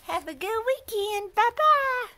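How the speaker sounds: high sing-song pet voice. Real, not acted. Talking for dog, farewell.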